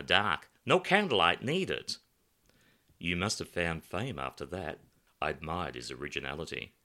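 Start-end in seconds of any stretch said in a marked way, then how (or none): none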